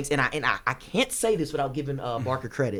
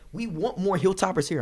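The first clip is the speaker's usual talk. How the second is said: talking very fast; 5 semitones higher than usual. Real, not acted. Candidate rushing his appeal for more Hilltoppers, talking very fast. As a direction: get the words out in a hurry. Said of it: "I'm sorry, I'm talking very fast".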